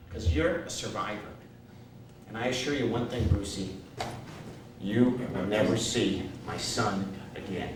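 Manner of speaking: low, hushed promise; solemn assurance. Retold or imitated. Agent assuring his client about his son, solemn and quiet.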